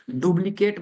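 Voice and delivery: "डुप्लीकेट" said with a B sound in place of P; mispronounced with B. Shown as a demonstration. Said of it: "ब बोलते हैं, ब नहीं बोलना". ("डुप्लीकेट" में प की जगह ब का उच्चारण)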